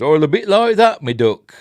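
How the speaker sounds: high, loud mock West Country accent; jokey West Country burr; West Country farmer accent. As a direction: put on a southwestern English farmer's accent. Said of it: "like, a farmer's accent".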